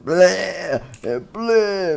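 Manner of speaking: high drawn-out bleh; fight noises. Acted. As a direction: squawk out attack noises.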